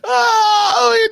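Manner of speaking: crying with laughter. Real, not acted. Laughing fit after the joke.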